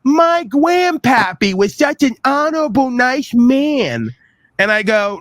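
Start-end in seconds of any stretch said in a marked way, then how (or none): 0.0-4.1 high mocking sing-song of a gullible fool; mocking dunce voice. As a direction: mimic a naive grandson, higher. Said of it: "every other dunce all over this land who's gonna say".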